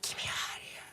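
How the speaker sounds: strained whispered scream, barely any sound; hushed, breath-only imitation of yelling; voiceless mock scream. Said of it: "I'm screaming, like".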